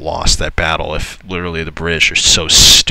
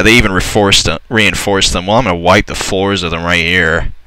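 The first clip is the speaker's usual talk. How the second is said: usual speaking voice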